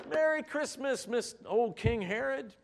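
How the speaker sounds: high, loud mock yell of a holiday greeting; exuberant yelling. Shepherds greeting King Herod after knocking on the window, exuberant yelling.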